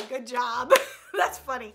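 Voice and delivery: ordinary talk, nothing marked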